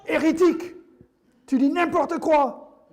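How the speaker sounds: mock-outraged outburst, high and loud; indignant heckler. Congregant heckling the preacher mid-message, mock outrage. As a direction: cry it out like an offended accuser, hammed up.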